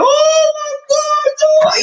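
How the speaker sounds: long, very high wailing sobs; mock crying. Mimicking the scammer crying on a call, mock wailing. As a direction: imitate a man bawling down the phone. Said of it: "He was really crying".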